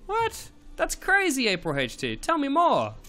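high, loud astonished squeal; playfully over-the-top surprise at a viewer's news; mock amazement. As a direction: gush like you can't believe it.

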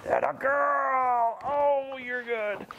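very high, slow, gushing praise; drawn-out sweet dog praise. Real, not acted.

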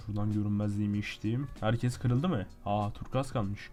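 low, hushed muttering; talking quietly. Real, not acted. Player sneaking invisible into danger, talking quietly. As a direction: keep it down as if sneaking. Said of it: "Ben niye sessiz konuşuyorum".

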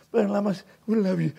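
soft, low imitation of a concerned wife; lightly voiced, hushed plea; gentle pleading quote. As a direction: retell it softly and carefully. Retells a church member's gentle complaint.

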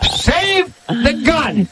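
very slow, high, hushed declaration; slow high dramatic hush; mock heroic. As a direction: proclaim it like a dying hero.

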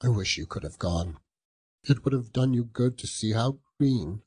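grieving father. Acted. Bob tells his wife of the grave, grief breaking through. Bob speaks of Tiny Tim.

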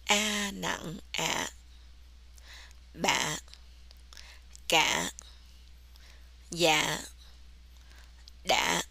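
"Bả, Cả, Dạ, Đã" cut short and dropped low. Shown as a demonstration. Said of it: "makes the word sounds shorter and low".

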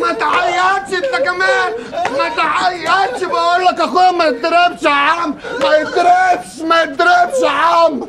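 high shouted tirade; angry ranting. Acted. Ranting about his brother.

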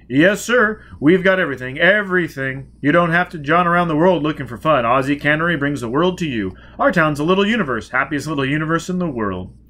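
loud, high, pushy sales pitch; booming salesman mayor. Acted. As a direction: sell the town like a carnival barker.

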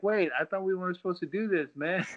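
high, protesting disbelief; mock indignant surprise. Fighter to the champion, protesting in high surprise. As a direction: cry foul, half laughing. Swept after being told ease up.

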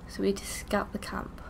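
hushed, breathy whisper while sneaking. Real, not acted. Sneaking past a camp, whispering. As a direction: whisper so the enemy won't hear.

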